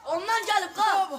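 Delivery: shouting. Real, not acted. Yelling during game.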